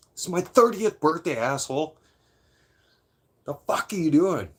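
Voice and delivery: birthday host yelling at his friend in the cab, loud and high; retold furious yell. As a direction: replay grabbing him and yelling in his face. Grabbing friend's shirt in cab.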